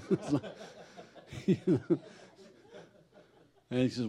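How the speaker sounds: ordinary delivery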